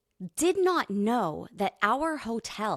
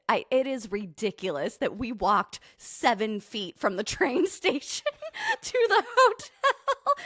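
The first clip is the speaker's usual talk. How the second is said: laughing admission; 4 semitones higher than usual. Real, not acted. Admits the walk was absurd, laughing.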